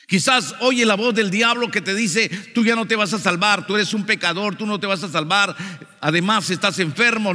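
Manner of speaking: insistent pulpit delivery, high and forceful; fervent preaching. Real, not acted. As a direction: press the warning on the congregation.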